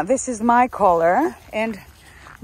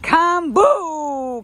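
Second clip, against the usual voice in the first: high, drawn-out loud call; 7 semitones higher, 5 dB louder than usual. Real, not acted. Owner summoning her dog boo, long high call. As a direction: call the dog in, stretching the vowels.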